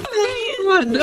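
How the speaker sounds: tearful joy. Acted. Mother embraces returning child.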